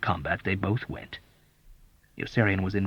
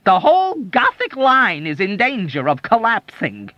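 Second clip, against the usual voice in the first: high, loud tearful lament; on the edge of bursting into tears; near tears; 12 semitones higher, 6 dB louder than usual. A narrator's reading. Doctor lamenting tearfully to the soldier. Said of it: "ready to burst into tears".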